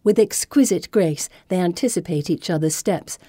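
ordinary delivery

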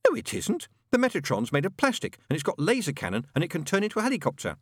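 put-on high-pitched child contradicting adults; high, know-it-all kid voice; argumentative Pepper. A narrator's reading. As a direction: pipe up high and insistent, rushing at the end.

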